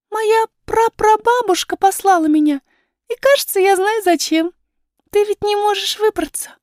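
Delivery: put-on high girlish voice, sure of herself; high, assured child's voice; confident young princess. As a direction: pipe up eagerly as a little princess. Princess's figure in the story.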